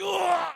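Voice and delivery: high strained ugh; mimicked cry of pain; pained groan. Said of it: "I was like".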